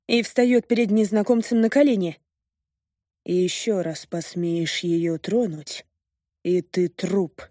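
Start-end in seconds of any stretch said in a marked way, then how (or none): 3.2-7.4 slow, cold, deathly menacing voice; cold sepulchral voice. Acted. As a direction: speak like a voice from the grave. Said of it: "холодным замогильным голосом произнёс мужчина".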